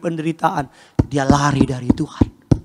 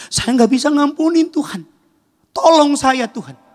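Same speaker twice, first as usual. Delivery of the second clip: high pleading that drops away; anguished prayer; 9 semitones higher, 4 dB louder than usual. Acted. Believer begging God for help to forgive, anguished. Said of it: "doa sama Tuhan ngomong begini".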